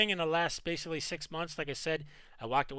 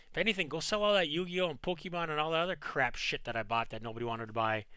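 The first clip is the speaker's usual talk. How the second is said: ordinary talk, nothing marked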